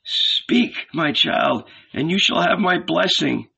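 high, quavering encouragement; imploring old man. A narrator's reading. Old Ananias begs Thomas to go on, imploring. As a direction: urge on hopefully in a high voice.

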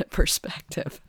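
laughing through the word perspective, breathy with amusement. Real, not acted. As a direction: let a real laugh carry the word. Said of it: "I'm laughing because we all do this".

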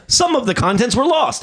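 high mock exasperation; retold high-pitched frustration; exasperated caller. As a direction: plead with a useless phone robot. Caller arguing with phone robot.

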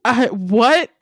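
loud, high, incredulous questions; baffled disbelief. Retold or imitated. Host reenacting hearing the garbled line, loud bafflement. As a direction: exclaim it, utterly lost. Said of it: "I was just sitting here like".

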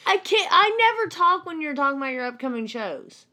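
high, loud objection; exasperated protest. Real, not acted. Co-host defending herself against host's accusation, loud and exasperated.

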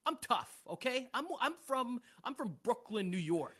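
puffed-up New York tough-guy voice; mock tough guy. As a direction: act hard like a Brooklyn tough. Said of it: "he's always doing that tough".

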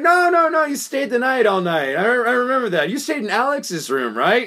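high, insistent mock correction; retold loud exposing. Retelling exposing her night in a busy salon, loud insistence. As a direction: call it out publicly, pitched well up. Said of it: "I was saying it loudly".

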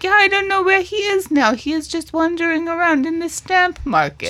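high, airy put-on woman's voice; breezy, high-voiced and unbothered; unconcerned mother. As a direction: play her lightly in a high-pitched voice. Acting out unconcerned mother's reply.

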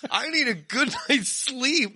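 ordinary talk, nothing marked

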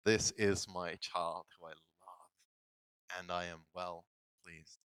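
gentle beaming blessing; tender blessing. Retold or imitated. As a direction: speak it like a proud gentle father. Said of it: "God beams at us".

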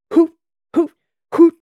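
high, loud acted bursts; three hard drill breaths, spaced apart; forceful breathing hoots. A narrator's reading. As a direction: puff out each hoot with force. Breathing drill during imagined drop.

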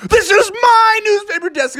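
very high, squeaky protest; squeaky indignant guy. Acted. Rival paper guy insisting to Froggy, very high squeaky voice. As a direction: squeak it out like a tiny outraged man.

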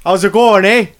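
put-on Canadian accent. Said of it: "slip into your Canadian accents".

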